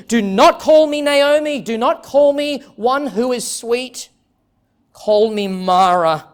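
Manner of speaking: anguished plea. Acted. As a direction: cry out Naomi's grief, slowing at the end. Naomi's lament voiced in sermon.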